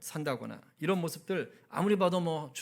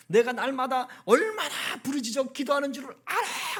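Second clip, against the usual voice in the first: mock furious scolding, higher and louder; hammed-up indignant yell; imitated angry rant; 8 semitones higher, 4 dB louder than usual. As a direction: act out a praying wife berating her husband. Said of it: "입이 확 열리면서".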